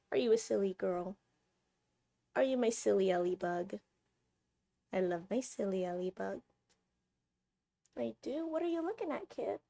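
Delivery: soft, affectionate pet talk; cooing to a pet. Real, not acted. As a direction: murmur fondly to your cat. Cooing fondly to the cat.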